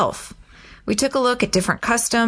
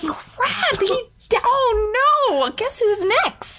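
panicked wife; 14 semitones higher, slower than usual. Retold or imitated.

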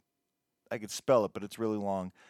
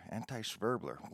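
much softer, muttered Anti-Schwerbler to himself; quiet repeat; 4 semitones lower, 12 dB quieter than usual. Real, not acted. Host repeating the odd title to himself, muttered softly. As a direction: echo Anti-Schwerbler under your breath, puzzled.

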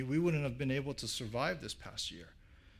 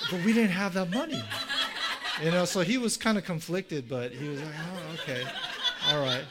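higher and louder, words broken by laughter; laughing while talking; 4 semitones higher, 7 dB louder than usual. Real, not acted. Telling a friend's funny story, laughing throughout.

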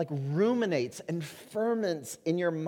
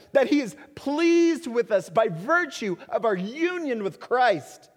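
louder, higher impassioned preaching; full-force preaching; 8 semitones higher, 7 dB louder than usual. Acted. Pastor urging his church, preaching at full force. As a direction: preach it to the back row.

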